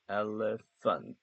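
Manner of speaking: elephant 아주 천천히 늘여서; elephant를 음절마다 길게; slow syllable demo. Shown as a demonstration. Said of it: "엘레 이렇게 끊습니다". (천천히 끊어 읽는 elephant)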